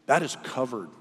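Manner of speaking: ordinary talk, nothing marked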